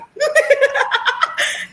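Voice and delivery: sudden high giggle; high laugh. Real, not acted. Laughing about her Missouri accent, high giggle.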